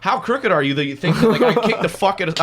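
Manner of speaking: high, loud retold disbelief; incredulous outrage. Driver's reaction once the cop walks away, incredulous. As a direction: exclaim half laughing, scandalised.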